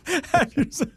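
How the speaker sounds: "Have yourself" laughed out high and slow; laughing high repeat. Shown as a demonstration. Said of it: "Have, have yourself a rice cake".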